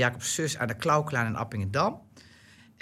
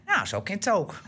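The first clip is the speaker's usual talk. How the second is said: lightly voiced Gronings-speaking mother, a bit higher; 5 semitones higher than usual. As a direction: mimic her dry Groningen remark in Gronings dialect. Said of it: "praat ik Gronings".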